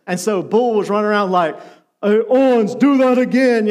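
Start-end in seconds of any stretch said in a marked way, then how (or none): 2.0-3.7 loud mock coach holler; deep-voiced coach impression. Team clown mimicking coach in locker room, loud and blustery. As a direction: clown around as the big coach. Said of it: "he had a really deep voice".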